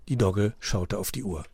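ordinary delivery, nothing marked

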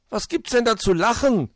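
high, loud huffy protest; indignant. A narrator's reading. Borstel objects to being laughed at, indignant. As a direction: demand it in outrage, voice way up. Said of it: "war Borstel entrüstet".

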